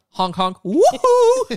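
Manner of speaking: goofy honks, then a wild high whoop; silly clown. Retold or imitated. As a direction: clown it up with a honk and a woohoo. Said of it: "He says".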